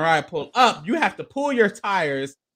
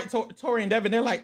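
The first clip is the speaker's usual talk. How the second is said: usual speaking voice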